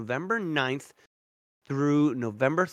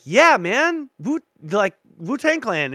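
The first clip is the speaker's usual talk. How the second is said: excited fan; 9 semitones higher, 8 dB louder than usual. Retold or imitated. Excitedly telling kid about shirt.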